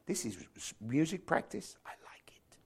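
fading to a breathless whisper as he creeps out; hushed whisper. Retold or imitated. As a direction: whisper so as not to disturb anyone. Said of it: "so I crept out".

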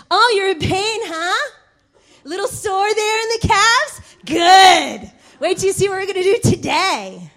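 mocking coach. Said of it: "He was like".

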